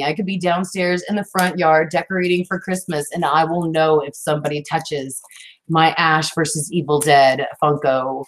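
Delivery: normal talking voice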